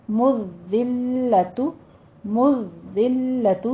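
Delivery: مذلت very slow, pausing on each doubled letter; drawn-out, firm recitation of مذلت; firm held doubling. Shown as a demonstration. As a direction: press and hold ذّ and لّ in مذلت. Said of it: "سختی کر کے تھوڑا رک کے".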